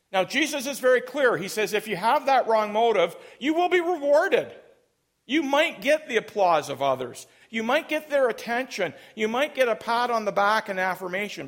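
loud, high-pitched sermon delivery; full-force preaching. Real, not acted. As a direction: drive each clause home to the congregation.

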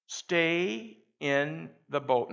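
a preacher's refrain, slow and firm; slow emphatic refrain. Acted. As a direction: Stay in the boat drawn out slowly, leaning on stay.

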